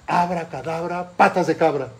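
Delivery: put-on boy bellows the full spell, loud. Boy tries the full spell on the tree, loud. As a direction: cast the spell with gusto.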